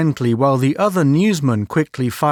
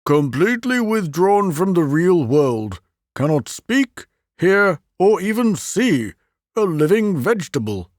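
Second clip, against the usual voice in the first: lightly raised, grave administrator; a hint of a somber official; university president voiced; 6 semitones higher than usual. The president describing Dulac, a touch grave.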